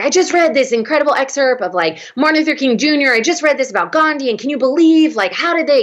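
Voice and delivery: high, eager, breathless rush of ideas; mock starry-eyed student gushing; gushing excitement. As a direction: bubble over like a fan. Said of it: "I'd be like".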